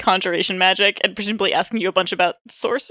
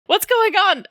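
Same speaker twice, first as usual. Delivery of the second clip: high, bewildered cry; acted flustered protest; startled confusion; 11 semitones higher, slower than usual. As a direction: ask it high, caught off guard. Acted while being dragged away.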